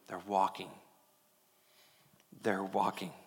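soft, low, gentle affirmation; hushed, fatherly and unhurried; tender quiet pride. Acted. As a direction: murmur it with loving assurance. Jesus gently affirming those who fall.

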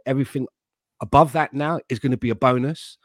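ordinary talk, nothing marked

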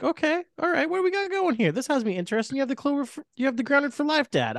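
excited high-pitched voice; intrigued, high voice. Retold or imitated. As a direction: pitch way up, eager and curious. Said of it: "had me like".

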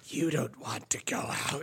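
breathy hush of the depression voice; whispering wraith. Retold or imitated. Depression whispering to the friend, tempting him.

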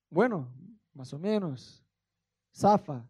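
ordinary delivery

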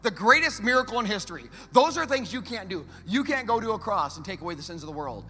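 loud, driving sermon climax; full-force preaching. Acted. Pastor proclaims the resurrection to the congregation, loud and driving. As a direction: preach it to the back row.

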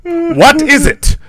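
high loud frantic line; dramatic movie line. Acted. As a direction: play the horror hero. Quoting the film.

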